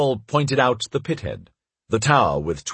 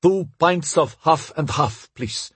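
put-on slow, high foreign-accented English; careful newcomer's English with a foreign accent; foreigner's English; 5 semitones higher, slower than usual. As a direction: order haltingly in a foreign accent. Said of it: "said in English".

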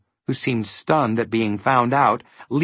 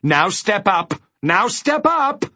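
high call, climbing higher on the repeat; recommending call; 8 semitones higher than usual. A narrator's reading. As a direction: urge the step in a high, lifted voice. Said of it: "it recommended".

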